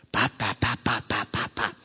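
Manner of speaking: string of quick, even pops; imitated popping noise. Father mimics the noise his son keeps making, rhythmic popping. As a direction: pop it out steadily, over and over.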